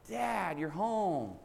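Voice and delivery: put-on high, drawn-out delighted child's greeting; excited boy. Son hugs his father at the door, high and drawn-out with delight. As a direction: stretch it out, overjoyed to see him.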